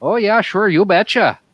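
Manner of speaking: hammed-up Yooper Wisconsin accent, "you betcha there, eh?". Bragging about deer camp to friends, Yooper accent. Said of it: "trying to do more Yooper or more Canadian".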